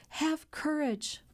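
high, drawn-out plea, slow and urgent; distressed cry. A narrator's reading. Daughter urges her father to be brave, distressed cry. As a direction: narrator acting a girl's heartfelt appeal. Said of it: "she cried".

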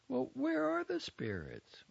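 high, frightened little voice; trembling whisper. Acted. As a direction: ask it nervously, voice quivering. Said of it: "asks in a tremulous whisper".

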